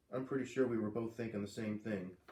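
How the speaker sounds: ordinary delivery, nothing marked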